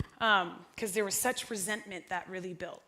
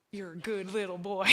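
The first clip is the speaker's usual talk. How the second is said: John Crowder impression; 3 semitones lower, 5 dB quieter than usual. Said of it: "can't do the best John Crowder impression".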